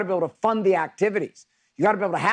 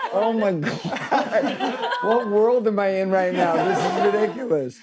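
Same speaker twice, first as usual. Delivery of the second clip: talking through real laughter, the first words dragged out very slowly; laughing in disbelief; 3 semitones higher, slower than usual. Real, not acted. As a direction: crack up while you say it, unable to believe it. Dad laughing at his daughter.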